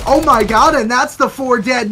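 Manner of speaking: loud hyped play-by-play call; excited shout. Real, not acted. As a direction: erupt over the big kill. Caster reacts to big play.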